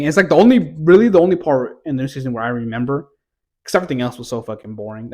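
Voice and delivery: ordinary talk, nothing marked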